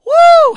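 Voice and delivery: very high, very loud whoop of joy; joyful whoop. Retold or imitated. Old woman praises God for the bread, loud joyful whoop. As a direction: let out a shouting woo. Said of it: "she went to shouting".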